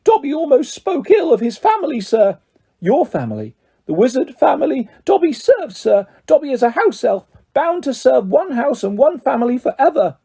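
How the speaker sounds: high, fluttering elf voice; a small creature overjoyed to meet his hero; eager house elf. A narrator's reading. Elf meeting Harry Potter at last, high and eager.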